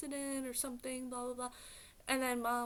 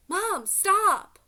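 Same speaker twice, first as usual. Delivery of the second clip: louder, much higher "Mom, stop!" shout; retold loud outburst; 8 semitones higher, 14 dB louder, slower than usual. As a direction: snap at mom to cut her off. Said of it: "I said it, like, loud".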